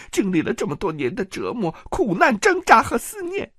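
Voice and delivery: high tearful woman's voice, bitter and accusing; a wronged wife spilling every secret through tears; tearful jealous aunt. Acted. Jealous aunt confessing her crimes to Petyr in tears. Lysa's long jealous tirade.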